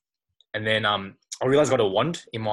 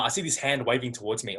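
ordinary talk

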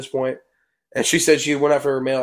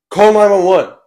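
high, raised-voice emergency order; retold urgent call; 6 semitones higher, 5 dB louder than usual. Teller orders friend to get help, retold urgent call.

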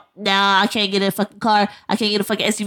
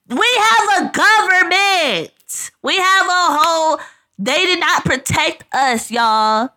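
loud, higher, slower indignant shouting; angry rant; 9 semitones higher, 5 dB louder, slower than usual. Real, not acted. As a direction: rail at the government, loud and slow. Drunken rant about the government.

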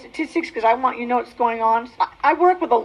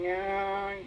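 a slow, low, shamed murmur; ashamed admission; 5 semitones lower, much slower than usual. Retold or imitated. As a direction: mumble it, head hung in shame. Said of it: "hang my head down and go".

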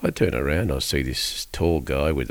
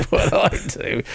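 breathy laugh; 10 semitones higher, slower than usual. Real, not acted. Laughs admitting his job.

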